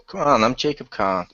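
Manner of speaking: usual speaking voice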